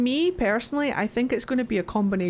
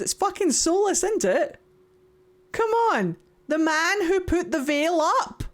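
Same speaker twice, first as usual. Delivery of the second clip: excited; 8 semitones higher than usual. Real, not acted. Gleeful theory rant.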